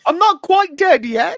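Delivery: very high, loud put-on voice; high-pitched mock protest; comic not-dead voice. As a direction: squeak it out loudly as a joke. Joking quote in a put-on voice.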